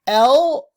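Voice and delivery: overdone teaching demo of "L,"; super exaggerated letter. Shown as a demonstration. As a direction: belt out the "L," to show the tongue. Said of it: "I'm super exaggerating this".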